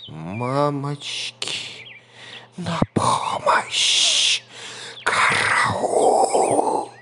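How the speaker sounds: slow, whispered cry for help; panicked snake. A narrator's reading. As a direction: hiss out a frantic call for help.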